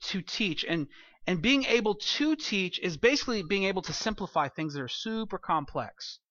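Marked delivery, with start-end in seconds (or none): none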